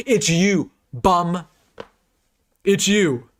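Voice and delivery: gleeful trash talk aimed at a rival fan; mocking taunt. Real, not acted. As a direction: rub it in his face, slow and gloating. Taunting rival channel on stream.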